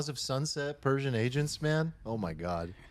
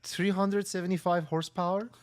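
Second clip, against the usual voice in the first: Persian car salesman; 6 semitones higher than usual. Pretend. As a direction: sell the car hard with a thick Persian accent. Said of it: "impersonate a Persian car salesman at a Mercedes dealership".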